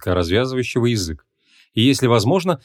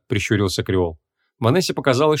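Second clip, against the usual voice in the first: ordinary delivery, nothing marked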